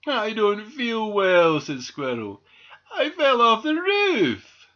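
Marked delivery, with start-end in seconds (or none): 0.1-1.6 high, slow, loud moan; sorry whine. A narrator's reading. Sick squirrel to doctor, sorry whine. 2.9-4.7 very high, slow, loud whine; pitiful wail. A narrator's reading. Hurt squirrel to doctor, pitiful wail.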